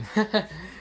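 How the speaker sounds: short acted chuckle; light in-character laugh. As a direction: give a brief imitated chuckle. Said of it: "kinda chuckles and says".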